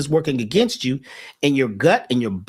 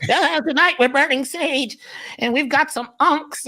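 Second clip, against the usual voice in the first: much higher put-on voice; mock conscious hipster; 12 semitones higher than usual. Mocking so-called conscious folks bragging about sage, high put-on voice. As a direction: lampoon the sage-burning crowd up high.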